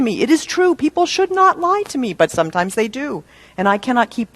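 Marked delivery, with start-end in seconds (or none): 0.2-2.1 high, sing-song mock of hurt entitlement; high-pitched mock protest; mock indignation. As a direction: ham up the wronged innocent. Mocking an entitled expectation.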